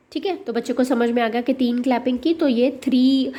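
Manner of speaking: ordinary talk, nothing marked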